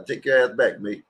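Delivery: ordinary talk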